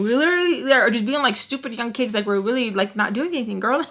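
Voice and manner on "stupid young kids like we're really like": usual speaking voice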